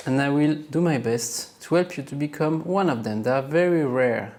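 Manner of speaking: usual speaking voice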